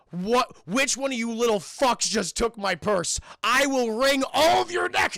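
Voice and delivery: loud, slow furious threat; angry threat. Retold or imitated. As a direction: menace the class over the purse. Madea furious about stolen purse.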